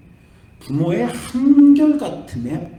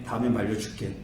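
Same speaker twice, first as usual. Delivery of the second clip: gentle apology; 6 semitones lower, 6 dB quieter than usual. Retold or imitated. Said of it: "저도 이제 나이를 들다 보니까".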